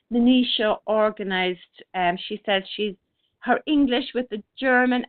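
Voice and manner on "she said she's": ordinary talk, nothing marked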